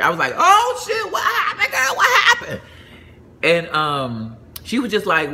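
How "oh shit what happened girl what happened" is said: very high, laughing shout; high, laughing "what happened" to a friend; shocked disbelief. Retold or imitated. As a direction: yelp it in startled alarm. Learning the wedding didn't happen.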